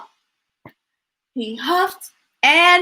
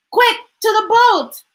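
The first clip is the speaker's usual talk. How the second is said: urgent; 10 semitones higher, 5 dB louder than usual. A narrator's reading. Urgent rescue command.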